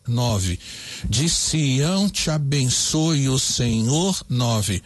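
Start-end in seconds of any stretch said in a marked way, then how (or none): 1.1-4.2 very slow, every syllable spaced out; measured scansion read; syllable counting. Shown as a demonstration. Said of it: "vamos contar".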